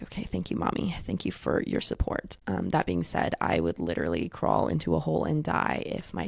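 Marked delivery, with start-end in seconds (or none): 0.0-2.4 hushed, breathy mock thank-you; a soft whispered joke of gratitude. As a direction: whisper it like a secret aside. Mock-grateful whispered reply.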